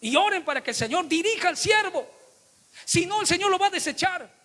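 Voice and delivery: high, loud preaching at full force; impassioned pulpit warning; fiery preaching. Acted. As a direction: preach it out with fire to the congregation.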